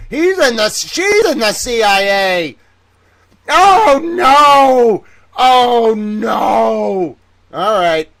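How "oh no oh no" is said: louder, higher cry of alarm; mock horror. Streamer reacting to chat's CIA reveal, higher and louder mock horror. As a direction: wail it like the sky is falling.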